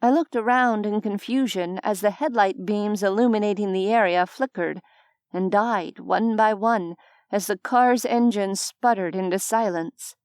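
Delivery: ordinary talk, nothing marked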